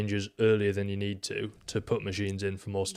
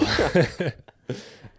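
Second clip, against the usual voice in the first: high giggle; 8 semitones higher, 3 dB louder than usual. Real, not acted. Laughing at the podcast change.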